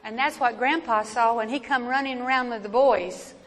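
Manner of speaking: usual speaking voice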